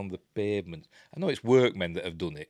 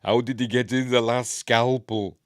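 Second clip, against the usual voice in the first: put-on Austrian Schwarzenegger accent; Arnie impression; 4 semitones higher, 7 dB louder than usual. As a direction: do Arnie's line in his accent. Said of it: "Arnold says".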